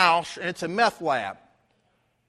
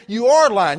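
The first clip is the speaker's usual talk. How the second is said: much louder, stress on are; insistent accusation; 3 semitones higher, 9 dB louder than usual. Retold or imitated. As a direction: push back hard, raising the voice. Escalating argument with Bubba.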